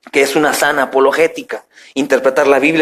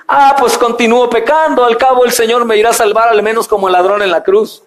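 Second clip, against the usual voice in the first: higher-pitched, glib mock reasoning; breezy self-justifying quote, pitched up; careless sinner's excuse; 6 semitones higher than usual. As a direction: play the sinner excusing himself.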